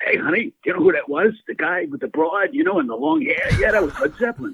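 high, fast Rickles impression; Don Rickles. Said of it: "and he's going".

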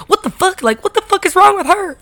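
high-pitched imitation of a bewildered roommate; Paige. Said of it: "I could hear Paige say".

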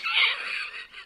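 squeaky breathless giggle; high giggle. Real, not acted. Giggling fit, can't stop.

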